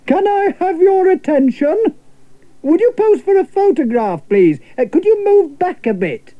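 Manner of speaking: very high, fussy photographer directing a crowd; chirpy high lady calling the group; Miss Fee, photographer. Acted. Miss Fee posing the crowd, very high chirpy calling. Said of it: "Cried Miss Fee".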